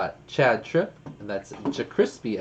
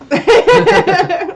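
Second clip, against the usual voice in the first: laughing squeal; 14 dB louder than usual. Real, not acted. Laughing at long German name.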